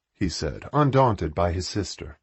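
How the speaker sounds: ordinary delivery, nothing marked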